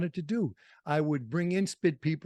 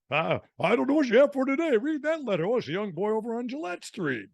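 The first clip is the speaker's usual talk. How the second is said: high, quick squeaky elf voice, much higher; 9 semitones higher than usual. Retold or imitated. Recalling the radio elf on the Santa show, high elf voice. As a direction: do the radio elf reading a letter. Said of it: "That guy's the elf voice?".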